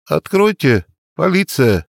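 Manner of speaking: muffled shout through a door; through a wall. A narrator's reading. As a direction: call from behind the door, muffled. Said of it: "глухо донеслось из-за двери".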